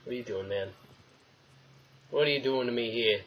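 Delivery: ordinary delivery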